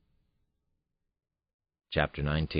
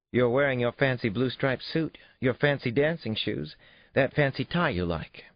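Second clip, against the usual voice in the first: put-on high, bright child's voice listing his clothes; teasing little girl; 10 semitones higher, 4 dB louder than usual. A narrator's reading. Daughter teases her dressed-up father, playful. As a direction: tease him like a kid who noticed everything.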